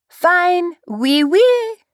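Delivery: high, hammed-up French accent; fake French accent. A narrator's reading. Narrator practising a French accent for her dare. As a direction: play up a cheesy French accent. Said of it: "Convince him you're French".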